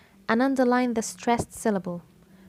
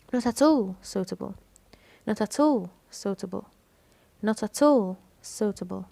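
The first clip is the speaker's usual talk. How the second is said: "all" stretched and punched in "Not at all suitable"; stress on ALL. Shown as a demonstration. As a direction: lean hard on "all". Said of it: "كلمة all". (التشديد على كلمة "all")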